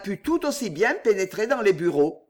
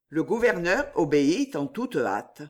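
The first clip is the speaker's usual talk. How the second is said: plain reading voice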